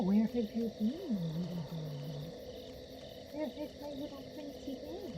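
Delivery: high, very soft coo; whisper-sweet to the cat. Real, not acted. Cooing to cat.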